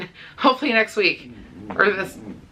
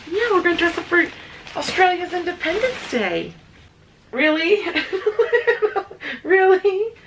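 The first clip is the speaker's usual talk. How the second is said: much higher, singsong teasing; playful pet voice; 10 semitones higher than usual. Real, not acted. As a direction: tease the dogs gently. Teasing dogs about costumes.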